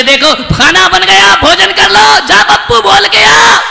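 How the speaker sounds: high loud call. Acted. Mother sending son to call father, shouted. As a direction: call the family to eat. बोले.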